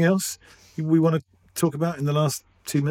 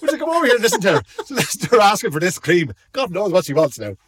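quick, high Dublin shop assistant; Dublin accent; 7 semitones higher, faster than usual. Retold or imitated. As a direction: play the amused Dublin shop girl. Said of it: "It was exactly like that".

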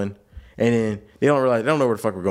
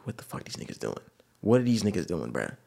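muttered under breath; 5 semitones lower, 8 dB quieter than usual. Retold or imitated. Impatient waiting in car.

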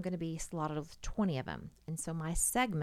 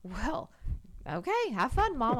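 son imitated; 8 semitones higher, 6 dB louder, slower than usual. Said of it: "And he goes".

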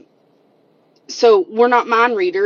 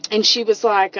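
ordinary delivery

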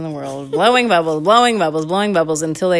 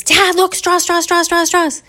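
high, loud squeal of excitement; rapid chant in a thrilled kid's voice; excited little girl; 13 semitones higher, 6 dB louder than usual. Acted. Candy spots straws in the store, excitedly calls Dad. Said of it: "Candy is so, so, so excited".

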